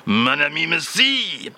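put-on slow, low French-accented crooner voice; a slow French charmer's voice. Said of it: "once more with your actual French filling".

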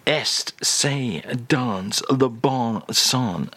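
very slow, drawn-out French; savoured French phrase. Acted. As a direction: linger lovingly on each word. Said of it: "I'll say that again because".

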